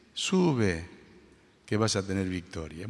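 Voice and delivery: low, soft, terse deadpan; very ironic. Retold or imitated. Micaías mock-prophesying victory to the king, ironic and terse. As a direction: say it dryly and quietly, not believing a word. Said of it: "con un tono muy irónico".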